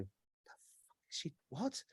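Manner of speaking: very soft, baffled aside; barely audible disbelief; baffled undertone. Retold or imitated. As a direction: say it almost silently, bewildered. Baffled reaction to the glitching cashier.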